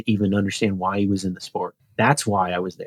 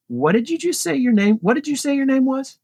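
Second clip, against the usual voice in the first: much higher, imitating a surprised asker; retold startled question; 14 semitones higher than usual. Dropped rider to the stranger who stopped, retold startled question. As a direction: re-enact sudden disbelief, pitch jumping right up.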